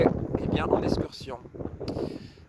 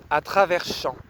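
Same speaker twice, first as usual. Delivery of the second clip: poème déclamé, posé. Real, not acted. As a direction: set each short phrase down deliberately, letting silence follow. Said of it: "les mots posés". (poème lu lentement, mots posés)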